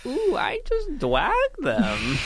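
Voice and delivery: high, slow cutesy lisp; baby talk. Pretend. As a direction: coo it like a smug toddler. Playful brag after roast.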